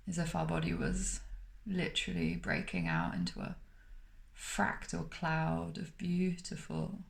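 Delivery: calm, unhurried meditation voice with long pauses between phrases; guided meditation. Acted. Meditation teacher guiding listeners to smile through the body, calm and spacious.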